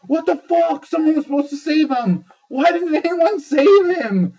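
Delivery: high, loud outraged cry; outraged disbelief. Retold or imitated. Reliving outrage that nobody saved Ned, high wail. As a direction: wail it like the hero was robbed. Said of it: "so I was like".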